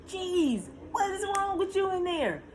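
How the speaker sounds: put-on high complaining to baby; fed up with waiting; exasperated. Exasperated at baby not coming.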